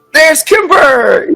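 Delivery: high, loud, drawn-out welcome cry; excited shout. Real, not acted. Host spotting the first guest joining the show, excited shout. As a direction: announce the arrival with a delighted shout.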